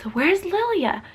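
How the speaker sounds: slow, high, drawn-out question; wondering toddler voice with swooping pitch; curious toddler. Retold or imitated. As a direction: imitate a puzzled two-year-old, stretching the words. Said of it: "he's, like, genuinely curious".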